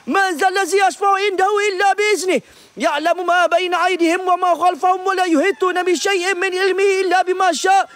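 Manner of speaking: high, fast, breathless prayer; frantic recitation. Real, not acted. Host recites Ayat Kursi in terror, high and fast. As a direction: rattle off the verse in terror.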